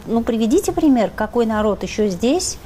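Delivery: ordinary talk, nothing marked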